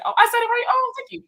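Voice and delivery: high, thrilled squeal of relief; crowing because she got it right; delighted surprise. Real, not acted. Streamer glad she said a viewer's name right, high delighted squeal.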